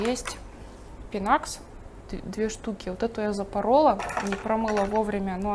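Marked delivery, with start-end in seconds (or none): none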